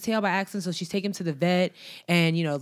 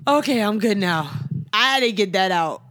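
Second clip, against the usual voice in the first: ordinary talk, nothing marked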